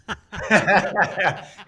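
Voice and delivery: high, loud burst of laughter; amused laugh. Real, not acted. Amused by ice plunge story.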